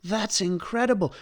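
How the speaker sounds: lightly voiced amazement; amazed. A narrator's reading. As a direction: marvel at it. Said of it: "exclaimed Maurice".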